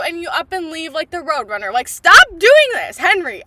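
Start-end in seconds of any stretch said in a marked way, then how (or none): 1.7-3.4 much louder, pleading scold; exasperated yell. Real, not acted. Fan shouting at Henry for running away, exasperated yell. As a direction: beg him to stop, at full volume.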